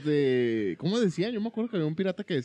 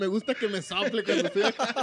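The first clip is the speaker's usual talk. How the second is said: laughing; 6 semitones higher than usual. Pretend. Joking quote, laughing.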